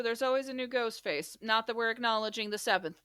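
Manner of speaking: usual speaking voice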